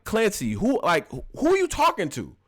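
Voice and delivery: high, offended challenge; indignant. Retold or imitated. Man scolding cashier Clancy, high offended challenge. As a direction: demand to know who he's talking to. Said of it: "I'm the type to be like".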